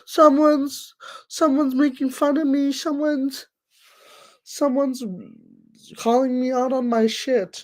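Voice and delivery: high-pitched mock complaint; mocking whiny troll. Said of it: "And then you complain".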